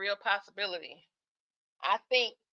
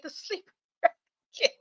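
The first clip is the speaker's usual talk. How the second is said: yawning laugh; 9 semitones higher, faster than usual. Real, not acted. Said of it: "this yawning is so embarrassing".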